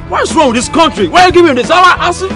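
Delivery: high, rising outrage; indignant protest. Acted. Customer rejects the drink given.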